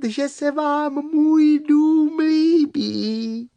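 tiny high-pitched worm voice, drawn out slowly; a little creature piping up, high and slow; small quiet voice. Acted. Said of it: "ozval se tichý hlásek".

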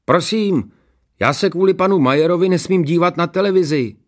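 high, loud complaint; tattling schoolboy. A narrator's reading. Pupil complaining to teacher before the class, tattling. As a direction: tell tales in a high pupil's voice. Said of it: "žaloval před celou třídou Kozelka".

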